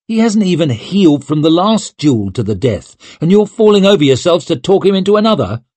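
high, urgent telling-off; indignant scolding. A narrator's reading. Friend rebuking the others for egging him on, scolding.

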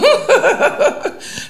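loud, high burst of laughter; laughing. Real, not acted. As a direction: laugh out loud at your own frankness. Laughs at her own candour.

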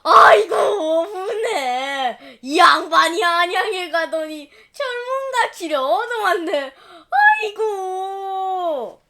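loud slow sobbing lament; wife wailing her husband brought a mistress. Acted. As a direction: wail and stamp in grief. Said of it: "울기 시작했어요".

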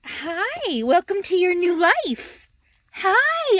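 puppy talk. Real, not acted. Owner greeting her new puppy in high baby talk. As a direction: coo to a brand-new puppy.

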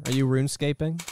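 ordinary talk

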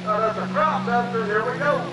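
usual speaking voice

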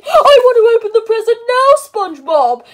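a lightly voiced Patrick wanting it now; whiny Patrick voice. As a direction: beg like an impatient kid. Patrick toy voice begging.

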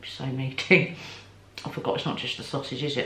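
talking behind hand while chewing; muffled words with food in mouth; mouth full, chewing. Real, not acted. Seen 0: Bites sandwich at -5; covers full, chewing mouth while speaking.

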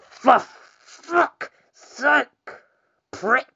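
high angry swearing; swearing tirade. Real, not acted. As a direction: snap furious curses at the screen. Raging at the game.

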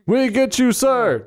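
high-pitched mock military response; troops answering commander. Acts troops answering their commander in chorus.

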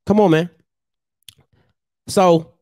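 ordinary delivery

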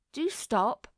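mild tearful whimper; gently voiced whimpering child. A narrator's reading. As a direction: plead as if starting to cry. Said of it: "whimpered Violet, beginning to cry".

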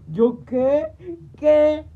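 high, loud shocked yelp; shocked outrage. Retold or imitated. Daughter shocked at the question, loud and high. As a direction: yelp it in disbelief.

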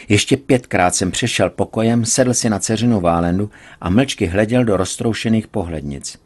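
ordinary delivery, nothing marked

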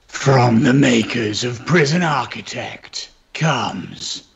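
booming movie-trailer announcer, low and slow; deep trailer voice. Pretend. Game developer hams up a trailer read for his colleague, deep announcer voice. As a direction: sell it like a cinema trailer narrator. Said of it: "I've got to get my deep voice going".

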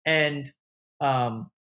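ordinary delivery

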